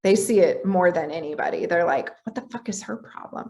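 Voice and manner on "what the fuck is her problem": hushed, half-whispered mock gossip; imitated muttering. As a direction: imitate someone complaining behind her back, much softer. Voicing the household's muttered reaction.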